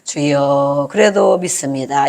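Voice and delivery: low, drawn-out pious prayer; mildly acted devout acceptance; pious believer. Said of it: "거룩하신 분은". (경건하게 낮은 소리로 기도하듯)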